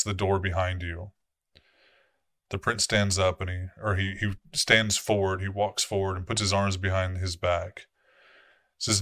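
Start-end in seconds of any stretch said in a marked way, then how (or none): none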